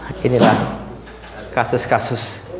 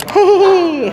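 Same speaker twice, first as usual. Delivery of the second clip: high teasing giggle; 5 dB louder than usual. Real, not acted. Teasing giggle over patient's pain.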